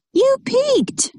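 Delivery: high, squeaky "You peeked!" as if caught out; indignant accusation. Acted. Child catching dad's vote, high accusing squeal. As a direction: call out the cheater in a shrill squeal.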